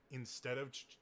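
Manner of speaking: ordinary talk, nothing marked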